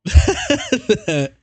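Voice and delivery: laughing through the word. Real, not acted. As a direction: giggle it out loud.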